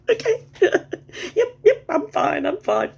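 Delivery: high breathless laughing; laughing fit. Real, not acted. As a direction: laugh through the words, can't stop. Laughing fit after joke.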